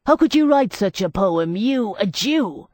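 loud, high outburst slowing at the end; blurting out. A narrator's reading. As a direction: burst out in shock. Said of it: "he blurted out".

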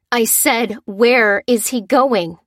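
slow, loud, menacing repeat; through gritted teeth. Acted. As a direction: grind each word out, losing patience. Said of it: "repeated Lucy through gritted teeth".

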